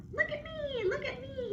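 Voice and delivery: very high, bursting with joy; excited dog voice. Acted. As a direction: show off to the world. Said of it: "he barked to the world".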